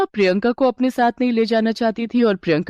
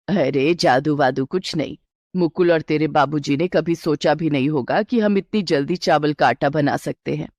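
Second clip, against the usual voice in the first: a lightly lowered, steady mother-in-law; 4 semitones lower than usual. Acted. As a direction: reassure the bride as a knowing elder. (हल्की सी नीची आवाज़ में सास)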